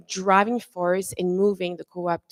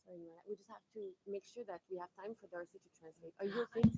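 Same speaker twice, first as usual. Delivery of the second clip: hushed, fast aside to the speaker; quiet aside; 19 dB quieter, much faster than usual. Real, not acted. Moderator quietly interrupts the speaker.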